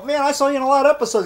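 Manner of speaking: high, fast fan enthusiasm; excited gushing. Retold or imitated. Fan gushing to a TV actor, excited.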